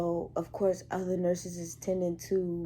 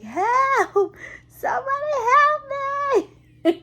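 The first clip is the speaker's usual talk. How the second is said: very high, loud drawn-out yell; screaming for help; 9 dB louder than usual. Retold or imitated. Nurse nobody hears on the bell, screaming for help. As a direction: re-enact a scream down the hall for help. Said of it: "I'm screaming. I'm like".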